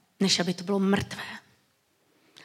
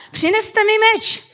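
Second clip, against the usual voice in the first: higher, louder kingly order; king Solomon ordering a sword, raised voice; royal command; 10 semitones higher, 6 dB louder than usual. Retold or imitated. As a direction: call for the sword with authority. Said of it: "A on tam říká".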